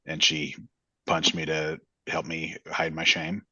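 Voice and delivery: usual speaking voice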